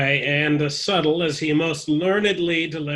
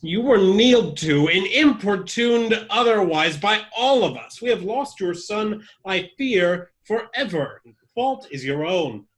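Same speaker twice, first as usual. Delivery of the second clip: reproachful; 5 semitones higher, 4 dB louder than usual. Acted. Sebastian blames the king loudly.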